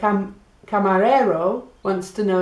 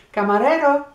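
higher, louder call for the waiter; 6 semitones higher, 4 dB louder, faster than usual. Shown as a demonstration. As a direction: hail the waiter across the room. Calling the waiter over.

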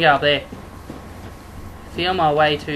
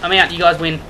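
ordinary delivery

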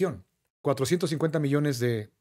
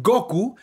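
Goku said GO-ku, stressed on the O; stress on first syllable; 5 semitones higher than usual. Shown as a demonstration. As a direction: show Spain's GO-ku for Goku. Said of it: "llevaría el acento en la O".

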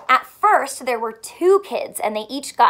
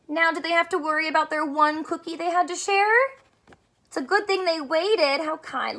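ordinary talk

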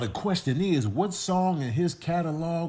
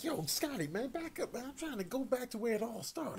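high squabbling critter; 8 semitones higher, 12 dB quieter, faster than usual. Acted. Voicing squabbling animals.

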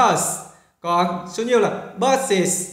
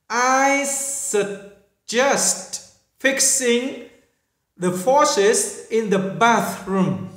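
very slow, each English word spelled out; slow model reading; 3 semitones higher, much slower than usual. Shown as a demonstration. Teacher slowly models a suggestion for the class. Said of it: "từ tương đối khó phát âm".

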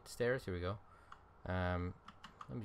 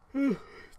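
long high yawn; loud yawn; 9 dB louder than usual. Real, not acted. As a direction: let out a big tired yawn. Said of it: "Sorry for the yawn".

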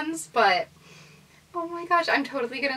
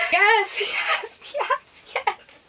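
ecstatic yes; 9 semitones higher, much slower than usual. Real, not acted. Overjoyed at gift.

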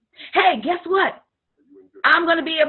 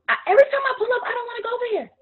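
whiny client; 10 semitones higher, much faster than usual. Retold or imitated. Client complaining, quoted.

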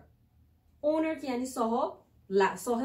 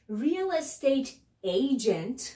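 slow, drawn-out term; slow teaching; 3 semitones higher, much slower than usual. Shown as a demonstration. Teacher naming the job, slowly and clearly. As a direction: spell it out in sound for learners.

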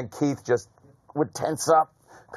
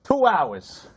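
high, drawn-out mock voice; quoted comic character; 6 semitones higher than usual. Comic retelling a bit from a friend's show, high mock voice. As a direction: imitate a comedian in a raised voice. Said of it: "he goes".

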